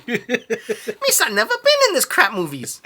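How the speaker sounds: high, slow goofy Gungan voice; hammed-up Jar Jar impression. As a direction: drawl it like Jar Jar. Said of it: "Misa (Jar Jar speech)".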